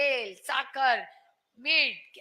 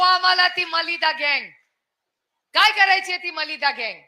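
mocking rally shout; 4 semitones higher, 7 dB louder than usual. Real, not acted. Rally speech attacking opponents.

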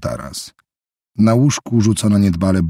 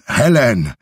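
calling out; 5 semitones higher, 4 dB louder than usual. A narrator's reading. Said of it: "wrzeszczał raz po raz".

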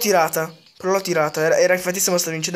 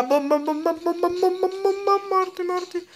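much higher, rapid repeated warning; excited callout; 10 semitones higher than usual. Real, not acted. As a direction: call out the bomb urgently, rising high. Calling out grenade, excited.

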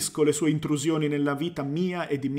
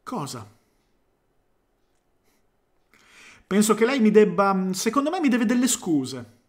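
indignant demand; 4 semitones higher than usual. A narrator's reading. Clark demands apology.

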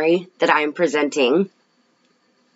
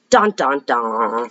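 dramatic suspense; 5 semitones lower, slower than usual. Acted.

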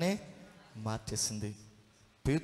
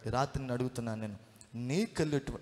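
normal talking voice

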